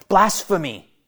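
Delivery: ordinary talk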